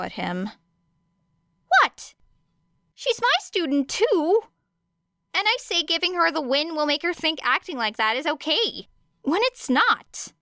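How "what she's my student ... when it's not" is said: high, excited defensive protest; higher voice for Hizashi protesting; Hizashi's voice. A narrator's reading.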